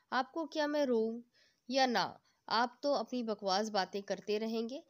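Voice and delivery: usual speaking voice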